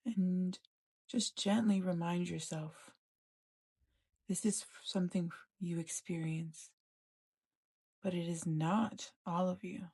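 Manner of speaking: calm, even meditation voice with long pauses; guided meditation. Acted. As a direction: gently guide them inward, leaving space between phrases.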